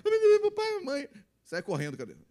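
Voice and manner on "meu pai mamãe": put-on high, loud wail of a lost child; mock desperate. Abandoned child running after parents in the mall, mock desperate. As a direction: cry out for mum and dad, pitched way up.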